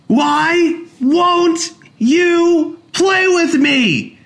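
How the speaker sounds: put-on high, very slow, loud child's whine; long wailing plea to play; whiny child. A narrator's reading. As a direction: drag it out like a sulking boy. Child whining.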